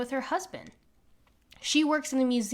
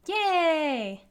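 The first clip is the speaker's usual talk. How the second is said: cheer; 4 semitones higher than usual. Acted. Mary glad about money.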